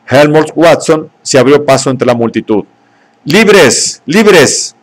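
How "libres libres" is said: high ringing cry of freedom; the Savage hurls it at the crowd, shouting; shouted. A narrator's reading. Savage shouting freedom at the crowd from the window. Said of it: "gritaba el salvaje".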